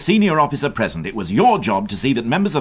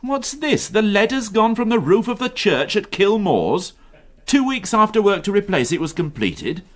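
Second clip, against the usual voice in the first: much higher, disbelieving questions; can you believe this; incredulous; 10 semitones higher than usual. Acted. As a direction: inspector scoffing at the report. Incredulous at church lead theft.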